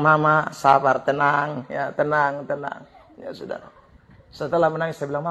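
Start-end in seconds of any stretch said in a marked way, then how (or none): 0.0-2.8 soft, low soothing of a crying woman; quiet calming words. Retold or imitated. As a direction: hush her gently, fading softer. Pastor calming crying old woman.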